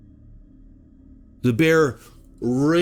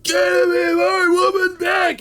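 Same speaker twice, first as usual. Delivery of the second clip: desperate shout; 3 dB louder than usual. Retold or imitated. Boyfriend runs after the bear, desperate shout. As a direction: holler it while giving chase.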